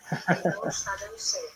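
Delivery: high laugh. Real, not acted. Host laughs at listener comment.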